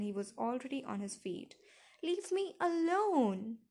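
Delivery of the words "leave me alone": high, loud, drawn-out cry; tearful scream. A narrator's reading. Daughter to her hovering father, screaming through tears. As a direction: scream it slowly to be left alone. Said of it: "I screamed at him through my tears".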